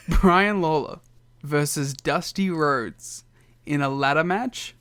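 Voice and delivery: bill the match like a big-fight announcer. Pretend. Ring announcer style billing.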